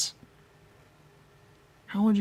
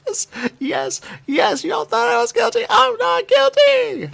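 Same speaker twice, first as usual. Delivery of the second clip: triumphant shout; 8 dB louder, slower than usual. Acted. Triumphant outburst after accusation.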